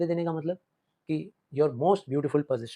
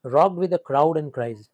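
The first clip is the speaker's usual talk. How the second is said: stretched, very slow, a bit louder; slow poem recitation; 6 dB louder, much slower than usual. Shown as a demonstration. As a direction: read the verse slowly, with weight. Teacher reciting the poem line. (धीमा, ज़ोरदार कविता पाठ)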